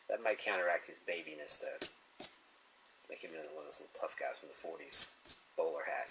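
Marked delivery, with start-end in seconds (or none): none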